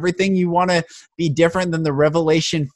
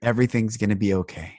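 softer, quiet comfort; gentle reassurance; 7 semitones lower, 5 dB quieter than usual. Retold or imitated. Man comforting a struggling cashier, gentle quiet reassurance. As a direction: say it tenderly to a stranger. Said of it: "And I said".

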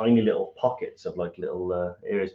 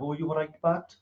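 higher-pitched put-on Welsh greeting; mock Welsh accent; 6 semitones higher, 3 dB quieter than usual. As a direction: imitate teasing done in a Welsh accent. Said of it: "because being Welsh".

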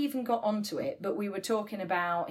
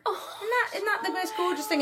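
high, teary and laughing; moved almost to tears, laughing; tearful laugh; 10 semitones higher than usual. Real, not acted. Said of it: "this just made me cry".

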